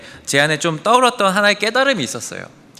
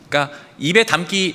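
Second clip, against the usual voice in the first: normal talking voice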